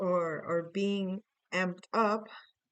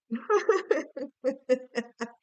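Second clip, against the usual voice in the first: high, loud burst of laughter; laughing; 5 semitones higher, 5 dB louder than usual. Real, not acted. Reader amused by her own rhyme, laughing. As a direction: crack up at your own joke.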